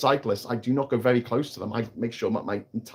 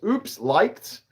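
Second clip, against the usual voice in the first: mock chat voice; 6 semitones higher than usual. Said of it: "says Chid".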